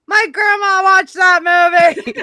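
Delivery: high, loud proud declaration; mock announcement. Co-host mock-announcing what she'd say on the show. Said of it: "the one thing I could say on Tug's show is".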